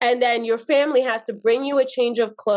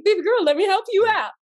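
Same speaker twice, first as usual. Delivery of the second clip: sweet coaxing; 8 semitones higher, faster than usual. Retold or imitated. Cousin sweetly helps her out.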